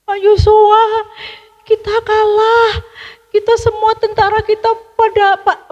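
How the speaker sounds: high, loud distressed report; frightened report. A narrator's reading. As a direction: gasp out the bad news to the leader. (lapor dengan panik, suara tinggi dan keras)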